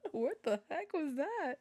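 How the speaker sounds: very high, startled question; amused shock. Real, not acted. Speaker baffled by the squeak from their throat, high and giddy.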